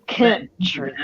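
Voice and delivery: "Country." breathed as a teasing unvoiced whisper. Answering the accent remark with a playful whisper. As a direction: whisper "Country" like a sly comeback. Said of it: "not that deep of an accent".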